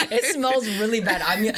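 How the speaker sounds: laughing it out, pitch jumping high and loud. Real, not acted. Laughing as she tells her friend the rain stinks. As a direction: say it through real laughter. Shared laugh over smell.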